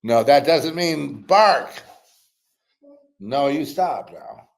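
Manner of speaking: loud, slow scolding of a dog; firm, loud, slow no to the dog; scolding a pet. Real, not acted.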